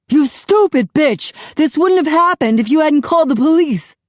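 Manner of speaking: loud, high raging bellow; husband bellowing at his wife. A narrator's reading. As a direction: scream blame at his wife. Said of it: "Charlie bellowing".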